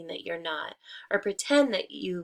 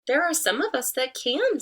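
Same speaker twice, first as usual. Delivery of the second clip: much higher, louder sly tree voice; 6 semitones higher, 6 dB louder than usual. Acted. Disguised wolf answering the hen, high and sly. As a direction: sing it out sweetly, hiding the wolf. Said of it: "said the tree".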